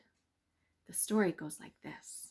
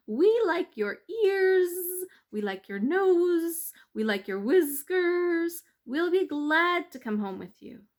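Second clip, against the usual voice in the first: buzzing bees; 10 semitones higher, 9 dB louder than usual. Acted.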